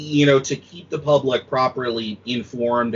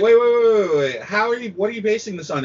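mock exasperated questioning; 9 semitones higher than usual. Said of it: "And you're like".

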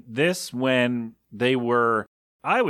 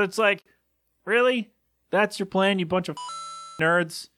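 incredulous mockery; 7 semitones higher than usual. Real, not acted. Mocking the rioters again.

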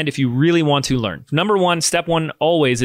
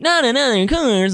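high, groaning mock of a reluctant student; hammed-up whine of a listener who hates courses; mock whiny complainer; 10 semitones higher than usual. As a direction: sneer it like someone sick of courses. Mocking people tired of courses.

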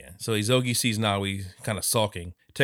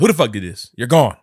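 angry; 11 dB louder than usual. Retold or imitated. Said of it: "And she's like".

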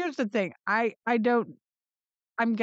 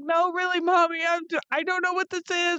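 put-on much higher, worried child voice; pleading little boy; 9 semitones higher, faster than usual. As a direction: beg mom to come look.

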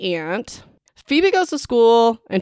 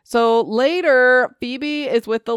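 So later drawn out very slowly, a bit higher; dramatic slow restart; 4 semitones higher, slower than usual. Shown as a demonstration. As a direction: stretch "So later" to build suspense. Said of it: "okay, this is what we really care about".

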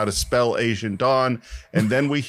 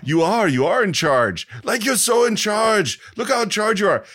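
gushing, higher and louder, in awe; excited Arnold; 9 semitones higher, 3 dB louder than usual. Retold or imitated. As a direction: rave about how in charge he is. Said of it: "Arnold, I can't do the accent".

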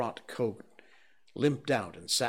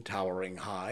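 usual speaking voice

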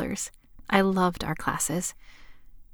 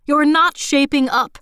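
higher and louder, relentless; wrathful scolding; 7 semitones higher, 5 dB louder than usual. Acted. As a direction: keep pounding her with fury. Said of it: "as if she couldn't turn off an internal faucet of her wrath".

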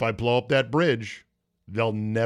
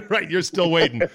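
laughing; 6 semitones higher, 4 dB louder than usual. Real, not acted. Laughing at guest's joke.

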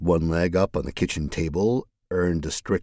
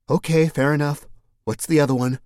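put-on young woman voice; 9 semitones higher, 4 dB louder than usual. Mercedes voice.

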